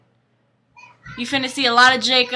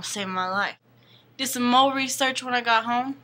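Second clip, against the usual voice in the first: ordinary talk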